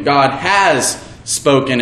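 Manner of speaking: "has" weighted, slow and deliberate; stress on HAS. Shown as a demonstration. Preacher demonstrating the stress falling on "has". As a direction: lean on the word "has". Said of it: "we placed the emphasis on each individual word".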